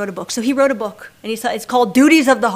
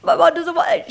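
mock crying, broken by halting pauses; too emotional to speak; 6 semitones higher than usual. Weeping daughter to stranger at airport, imitated halting sobs. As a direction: imitate choking on the words, voice climbing high. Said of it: "she can't even get the words out".

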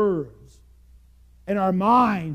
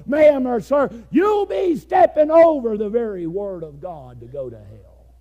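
loud, raised preaching voice; fiery preaching; 7 semitones higher, 8 dB louder than usual. Acted. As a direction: press the point hard on the congregation. Preaching at full force.

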